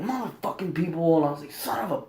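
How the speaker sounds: a quiet retold grumble of curses; angry mutter. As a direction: grumble the curses under your breath. Muttering angrily while coming home.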